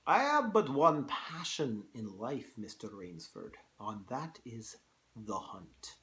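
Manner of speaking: put-on Russian-accented general confiding his passion; General Zaroff. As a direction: attempt a Russian accent, savouring the last words. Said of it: "hard to do a Russian accent".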